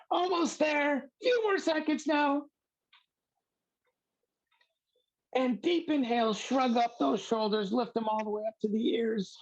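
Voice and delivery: a fading hint of the squeaky Siddha coach; lingering Siddha voice. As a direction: keep the high impression going as the count ends. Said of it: "I'm not doing a very good Siddha".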